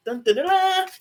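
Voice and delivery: sung-out high dun dun dun; mock drumroll. Host teasing the award reveal with a high mock drumroll. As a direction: hum a dramatic drumroll before the big reveal.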